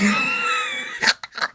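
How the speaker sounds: shrill burst of laughter; high squealing laugh. Real, not acted. Cracks up at the clip.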